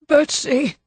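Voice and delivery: high despairing cry of a name; husband crying out on seeing his dead wife. A narrator's reading. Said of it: "The despairing cry".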